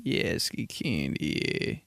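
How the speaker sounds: deep sleepy drawl; slow and low like just woke up; low lazy voice. Retold or imitated. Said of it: "we just woke up".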